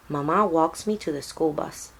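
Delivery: ordinary delivery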